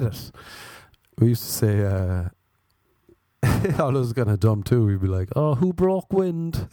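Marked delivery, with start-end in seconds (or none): 5.3-6.7 prim, posh mock. Family's prim euphemism.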